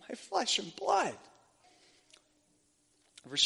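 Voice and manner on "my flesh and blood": laughing. Real, not acted. Joyful laugh while preaching.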